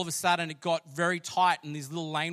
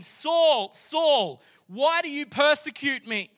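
high, loud cry from heaven; full-voiced, ringing challenge; commanding heavenly call; 12 semitones higher, 10 dB louder, much slower than usual. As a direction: call out as God confronting a man. Acts the voice from heaven.

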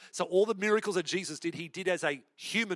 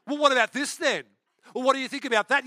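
mock deflection; 8 semitones higher, 6 dB louder than usual. Imitating smoke-screen excuses.

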